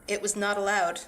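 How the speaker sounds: plain reading voice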